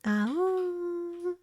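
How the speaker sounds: high, soft drawn-out howl; gentle high "awoo"; playful wolf howl. Pretend. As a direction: howl like a wolf, held long and quiet. Wolf howl welcoming new followers.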